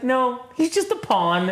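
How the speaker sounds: high, slow woman's voice; high sweet nurse voice. Retold or imitated. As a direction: say it sweetly, slowly. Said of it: "She's like".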